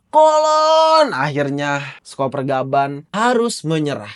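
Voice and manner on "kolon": higher, louder call of the son's name; anguished cry. Acted. Father crying out to his son, high and loud. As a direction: cry out for your child.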